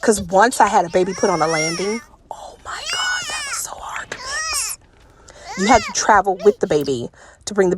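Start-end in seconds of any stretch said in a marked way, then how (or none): 2.3-4.8 whispered. Retold or imitated. Recalling a stuck baby.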